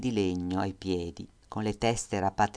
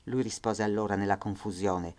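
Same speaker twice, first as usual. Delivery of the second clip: usual speaking voice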